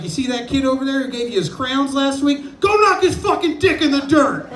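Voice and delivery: high, loud bellowing coach; coach yelling. Retold or imitated. The coach sends eleven-year-olds to war, bellowing. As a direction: yell it like a sideline dad gone mad.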